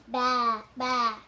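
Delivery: loud bleating baa; sheep baa. Shown as a demonstration. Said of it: "Can you make a sound like a sheep?".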